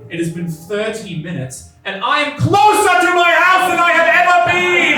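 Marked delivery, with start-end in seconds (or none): 2.0-5.0 rising to a high, loud exasperated shout; exasperated rant. Real, not acted. Comedian telling the crowd, building to a frustrated shout. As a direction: build it up until you're yelling. Venting frustration at the bus ride.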